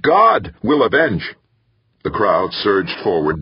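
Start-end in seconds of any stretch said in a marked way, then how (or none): 0.0-1.3 loud, high, slow proclamation; bellowing. A narrator's reading. Preacher warning the mob of God's vengeance, bellowing. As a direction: bellow each word out like a preacher. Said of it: "Danvers bellowed".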